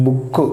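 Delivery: బుక్ with a short oo and quick k; short vowel. Shown as a demonstration. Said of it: "బుక్ కూడా short ప్రొనౌన్సియేషనే". (బుక్ లో పొట్టి ఊ శబ్దం)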